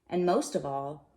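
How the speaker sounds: ordinary delivery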